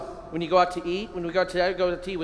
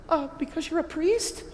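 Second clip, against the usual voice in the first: waitress reply; 10 semitones higher than usual. Retold or imitated. Waitress answering the priest.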